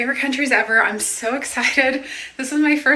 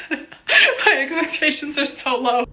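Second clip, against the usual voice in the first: higher, drawn-out words through laughter; laughing; 5 semitones higher, slower than usual. Real, not acted. Laughing at her low standards.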